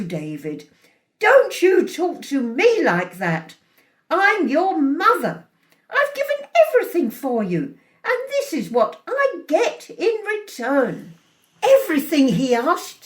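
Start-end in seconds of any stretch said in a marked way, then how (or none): 1.2-11.1 higher, louder, wounded outrage; indignant scolding. A narrator's reading. Mother telling off her ungrateful son, indignant scolding. 11.6-12.3 high, loud incredulous echo; somewhat angry. A narrator's reading. Son challenging his mother's claim, somewhat angry. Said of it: "somewhat angrily".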